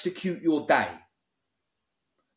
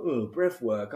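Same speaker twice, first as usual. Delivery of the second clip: low, scornful builder's grumble; mocking builder friends; 4 semitones lower, slower than usual. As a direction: lightly imitate a dismissive builder. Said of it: "That's exactly how they speak".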